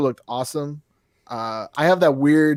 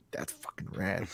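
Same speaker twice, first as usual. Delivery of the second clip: hushed, breathy whisper of delight; awed whisper; 7 semitones lower, 16 dB quieter, slower than usual. Real, not acted. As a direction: whisper it like a secret thrill. Sudden hushed aside.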